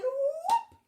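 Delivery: high, loud whoop as the book shuts; excited whoop. Shown as a demonstration. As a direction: yelp it out big and high. Book snapping shut in song.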